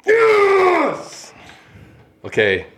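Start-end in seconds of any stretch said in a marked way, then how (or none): 0.0-1.3 high triumphant shout of yes; excited yes. Real, not acted. Player cheering a correct guess with a high shout. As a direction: celebrate the point with a high whoop. Wins the trivia round.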